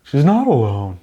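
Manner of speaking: high, dramatic hammy repeat; high hammed-up heroic line; mock movie line. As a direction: intone it like a stiff hero. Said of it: "You wouldn't say".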